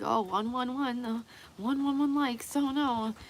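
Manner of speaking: high, fretful mock gasp over the numbers; mock superstitious fretting. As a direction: play a jittery magical thinker spotting omens. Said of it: "and be like".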